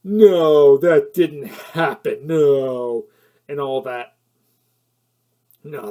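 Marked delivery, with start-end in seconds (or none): 0.0-3.1 loud, slow, scoffing dismissal; mock exasperated executive waving it away; dismissive studio exec. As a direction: brush it off like a fed-up producer. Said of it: "there's a studio saying".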